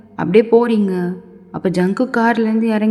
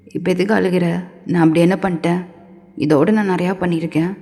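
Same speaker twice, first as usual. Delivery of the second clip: low-voiced scolding man; 3 semitones lower than usual. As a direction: order her about in a lower voice.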